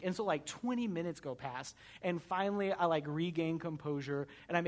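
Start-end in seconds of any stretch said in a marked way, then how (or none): none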